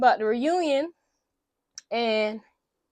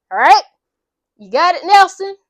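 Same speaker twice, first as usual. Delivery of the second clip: stern manager; 5 semitones higher, 10 dB louder than usual. Retold or imitated. Manager scolding actor Nelson.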